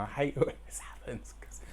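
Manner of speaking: whispered, holding back tears; choked-up real whisper; teary whisper. Real, not acted. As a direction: whisper it as emotion wells up. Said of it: "I'm gonna get emotional now".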